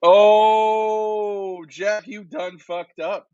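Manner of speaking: drawn-out teasing verdict; slow mock verdict. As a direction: stretch it out, teasing.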